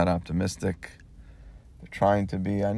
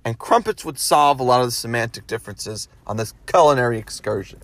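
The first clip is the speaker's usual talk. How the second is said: higher, louder indignant rant about crumpets; a real, heated disbelief building into a rant; worked up rant; 6 semitones higher, 4 dB louder than usual. Real, not acted. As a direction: get riled up and loud over crumpets. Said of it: "And now I'm all worked up over crumpets".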